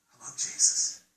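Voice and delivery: whispered confession; whispered to the congregation. Real, not acted. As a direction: breathe it out in a whisper. Preacher drops to whisper.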